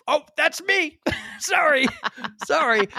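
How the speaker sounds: sheepish shout of surprise; excited shout. Retold or imitated. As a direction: yelp it out, caught off guard and embarrassed. Realizing he won his own prize.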